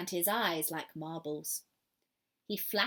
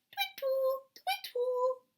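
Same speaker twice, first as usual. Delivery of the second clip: much higher, slow hooting twittle; owl call; much slower than usual. Acted. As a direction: sing the owl call up high. Girl imitates a tawny owl call.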